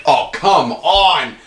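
long, drawn-out plea; retold exasperation. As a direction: stretch each word in mock disbelief. Retells exasperated reaction to film.

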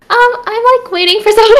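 very high-pitched, hesitant small voice; high, sheepish retold excuse; mock nervous. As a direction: imitate flustered stalling at the door. Acts out nervous reply to doorman.

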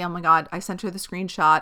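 ordinary talk

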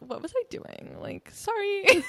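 high, drawn-out sheepish sorry; sheepish embarrassed. Retold or imitated. Friend after meltdown, sheepish drawn-out high apology. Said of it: "you're like".

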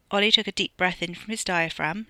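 ordinary delivery, nothing marked

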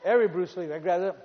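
ordinary talk

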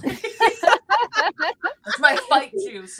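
laughing it out slowly. Real, not acted. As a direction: giggle through the line. Joking about being caffeinated.

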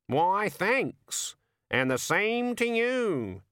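high, slow gleeful bird voice; delighted raven. Acted. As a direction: raven savouring luck. Raven replies.

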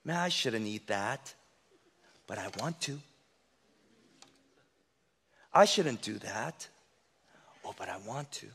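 soft, low inner wrestling; half-whispered inner debate; inner argument voice. Acted. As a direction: mutter the temptation to yourself. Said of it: "there's always two sides".

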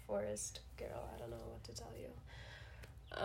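low unvoiced whisper. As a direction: hush it like a secret aside. Joking aside about birds.

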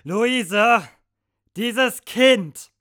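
furious mother shouting; exasperated yelling. Acted. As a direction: yell at the house over the screaming. Mother yelling over screaming child.

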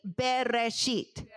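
Bereshit said slowly and carefully; Hebrew word. Shown as a demonstration. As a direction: spell out Bereshit syllable by syllable, slow. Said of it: "Can you say that with me?".

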